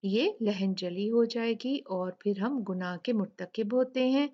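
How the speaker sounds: normal talking voice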